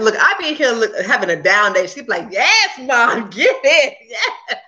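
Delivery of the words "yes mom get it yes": higher, laughing cheer; hype girl. Retold or imitated. Daughter cheering her mom on through a down day, hyped up. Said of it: "she's my hype girl".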